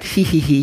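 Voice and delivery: high mock girlish tittering; girlish giggle. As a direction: giggle like a shy girl. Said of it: "mädchenhaft kichert". (mädchenhaftes Kichern, gespielt)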